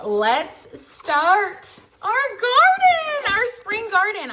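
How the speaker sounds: high, loud, drawn-out sing-song kickoff; excited announcement. Real, not acted. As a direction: sing it out like a big launch. Announces the start of the garden.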